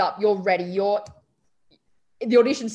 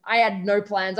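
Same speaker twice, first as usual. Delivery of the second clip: ordinary talk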